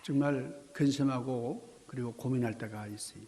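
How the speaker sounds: ordinary talk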